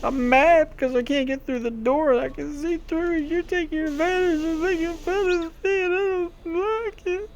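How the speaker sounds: mock crybaby whine about being teased; whiny crybaby. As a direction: sulk like a hurt little kid. Mocking himself as whiny kid.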